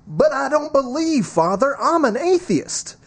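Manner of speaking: high-pitched mock objector voice; protesting atheist. Atheist objects to the Father in the homily, high-pitched protest. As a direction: put on a high, pleading character voice.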